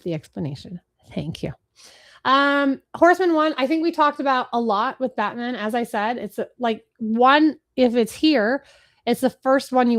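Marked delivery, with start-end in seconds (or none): none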